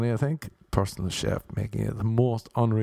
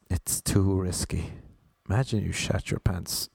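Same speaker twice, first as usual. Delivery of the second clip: ordinary delivery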